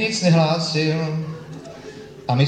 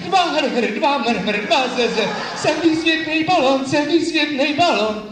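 raised, giddy crazed voice; a hint of a loon blowing his trumpet; mock madness; 8 semitones higher than usual. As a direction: play the gone-mad man with a laugh. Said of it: "Teď já jsem se zbláznil".

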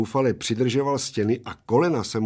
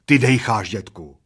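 louder accusation, falling away; bitterly astonished; 8 dB louder than usual. A narrator's reading. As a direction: accuse your old friend in disbelief. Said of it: "směsicí roztrpčení a údivu zároveň".

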